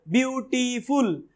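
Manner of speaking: Beautiful slowly in three parts, ब्यू-टी-फुल; split into syllables. Shown as a demonstration. Teacher breaking beautiful in three for students, slow and separated. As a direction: stretch each syllable of beautiful. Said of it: "तीन बार टूटेगा ही".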